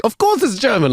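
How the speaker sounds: high, mock matter-of-fact; light imitation of a smug German; mock German guy. German guy correcting her, mock smug. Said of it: "the German guy was like".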